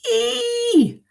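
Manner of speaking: very high, loud squealed howl; piercing mock "Ee!"; pained howl. A narrator's reading. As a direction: shriek it as a storybook yelp. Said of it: "howled Mister McGee".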